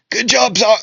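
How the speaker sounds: high, strained and weakly spoken, charred and beaten; weak Magna voice. Acted. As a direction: croak it out like a beaten fighter on the ground. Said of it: "he would say".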